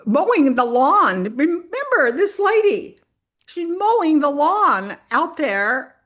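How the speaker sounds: loud, high, drawn-out amazement; incredulous emphasis. Real, not acted. Speaker reminds audience of the hunched lady mowing, incredulous.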